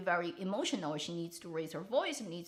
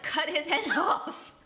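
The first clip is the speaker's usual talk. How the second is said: laughing through the punchline, higher and louder; 9 semitones higher, 7 dB louder, slower than usual. Real, not acted. Joking to viewers about cutting off his hand, laughing. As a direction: giggle out the joke.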